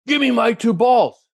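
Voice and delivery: high, loud shouted demand; imitated angry demand. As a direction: play the furious golfer confronting the kid. Angry golfer demanding his balls.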